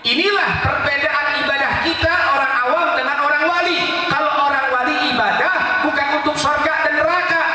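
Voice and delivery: full-force preaching. Acted. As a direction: preach it out to the crowd.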